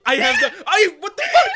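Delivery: loud, high, laughing outburst; startled shouting. Real, not acted. Gamer startled by Toad in the game, startled shout. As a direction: yell in real disbelief, breaking into laughter.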